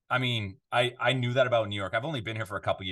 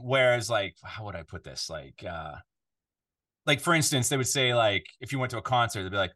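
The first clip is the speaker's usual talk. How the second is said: ordinary delivery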